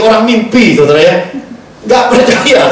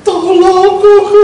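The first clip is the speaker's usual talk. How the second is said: much higher, tearful cry for help; crying plea; 14 semitones higher, 4 dB louder, slower than usual. Retold or imitated. Youngest sister phones her brother, a high tearful plea. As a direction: beg through tears, voice up high. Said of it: "telepon nangis-nangis".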